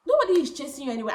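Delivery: emphatic. Real, not acted. Emphatic declaration to viewers.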